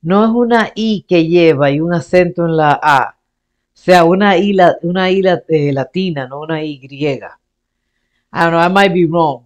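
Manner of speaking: normal talking voice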